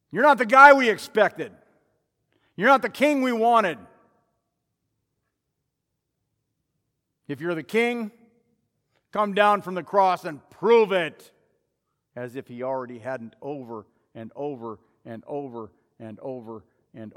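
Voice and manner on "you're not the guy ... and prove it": loud, indignant crowd jeer; angry. Acted. Disappointed crowd to Jesus on the cross, angry and loud. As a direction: hurl it out as an angry demand. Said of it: "just flat outright angry".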